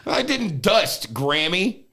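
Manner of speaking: high, slow mock protest. Grandson protesting to grandma about dusting, mock high protest.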